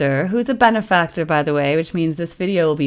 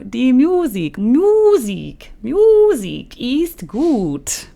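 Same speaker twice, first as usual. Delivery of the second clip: put-on German accent; 7 semitones higher, 5 dB louder, much slower than usual. Drawn-out German phrase.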